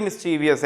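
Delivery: mischievous as mis-CHEE-vee-us; four-syllable mischievous inside the example sentence; common mispronunciation. Shown as a demonstration. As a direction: add an extra "ee" in mischievous, "vee-us". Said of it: "mischievous बोलते हैं ना".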